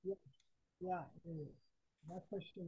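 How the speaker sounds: faint, distant caller voice; speaking far from the mic; barely audible. Real, not acted. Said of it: "your voice is very, very low".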